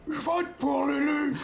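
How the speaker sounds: badly faked French accent, pitched high. Man disguising his voice to vote, faked French accent. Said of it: "juste un accent français".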